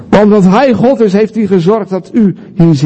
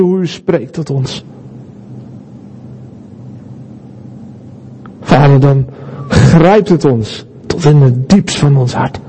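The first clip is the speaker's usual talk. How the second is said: prayer; 3 semitones lower than usual. Acted. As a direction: speak to God in hushed reverence. Leads the church in prayer.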